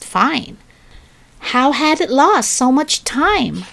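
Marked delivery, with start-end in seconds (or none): none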